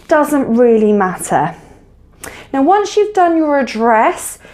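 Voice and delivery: ordinary delivery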